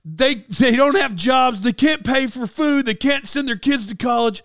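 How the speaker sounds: high-pitched mock tirade; imitated yelling. Tom Cruise chewing out his film crew, mock yelling. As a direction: imitate an irate star scolding the crew. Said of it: "he's yelling at 'em".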